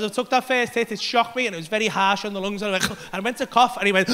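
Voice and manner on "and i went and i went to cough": normal talking voice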